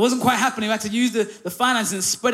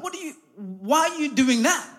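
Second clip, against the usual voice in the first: vexed; 5 semitones higher than usual. Retold or imitated. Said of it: "she was visibly vexed".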